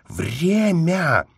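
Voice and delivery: high, drawn-out cry of alarm; alarmed gasp. A narrator's reading. Angelina seeing the clock, aghast. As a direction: cry out on suddenly remembering. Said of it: "посмотрела на часы и ахнула".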